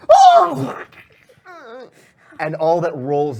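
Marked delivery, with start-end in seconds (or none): 0.1-0.8 very high, loud death scream; piercing cut-off shriek of a victim; sudden scream. Acted. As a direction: scream out at the top of the voice. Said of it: "All we hear is a".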